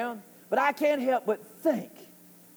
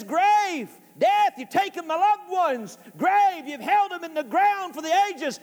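high, forceful preaching cry; impassioned confrontation; 6 semitones higher than usual. Acted. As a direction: accuse grave and death, pitched way up. Paul confronting grave and death.

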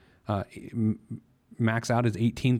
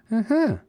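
high, louder approving uh-huh; 11 semitones higher, 4 dB louder than usual. Shown as a demonstration. Host turning a good savings tier into a sound, approving. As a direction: chirp a pleased uh-huh. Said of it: "express that via sound".

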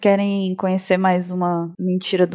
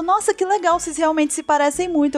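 higher, excited mock friend voice; delighted friend; 7 semitones higher than usual. Schoolmate to Bururu about her twin, higher, delighted. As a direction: gush like a gullible schoolmate.

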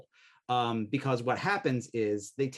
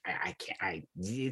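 unvoiced whisper, much softer; whispered aside; 5 semitones lower, 6 dB quieter than usual. Admitting a poor ear for accents, in a whisper. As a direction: whisper it like a sheepish confession. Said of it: "I'm always basically just like".

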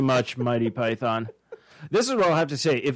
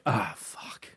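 whispered curse; 5 semitones lower, 8 dB quieter than usual. Pretend. Burglars find only junk.

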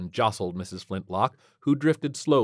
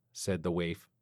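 usual speaking voice

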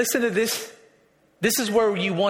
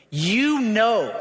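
"You" drawn out and raised, "know" falling; stress on YOU. Shown as a demonstration. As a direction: lean on YOU, not on I. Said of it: "Now the emphasis is not on I".

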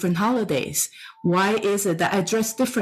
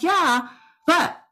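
exasperated excuse; 7 semitones higher than usual. Retold or imitated. Her excuse to husband.

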